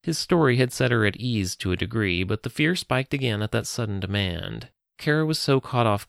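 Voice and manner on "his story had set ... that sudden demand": ordinary delivery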